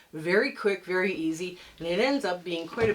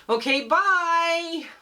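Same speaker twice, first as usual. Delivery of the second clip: cheery singsong goodbye; 9 semitones higher, 3 dB louder, much slower than usual. Real, not acted. Cheery video sign-off.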